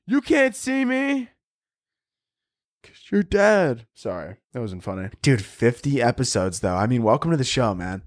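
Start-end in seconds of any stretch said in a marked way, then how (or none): 0.1-1.3 mock relative echoing taunt. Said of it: "stop it". 2.8-3.9 mock scolding relative.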